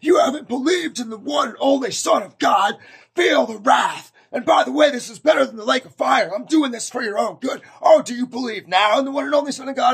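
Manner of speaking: high, loud hammed-up tirade; mock-zealous shouting. Zealot while pretend-scourging unbelievers in the street, shouting. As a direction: pretend to be a furious street evangelist. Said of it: "I started scourging them and I'm like".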